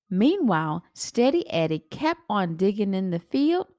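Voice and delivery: usual speaking voice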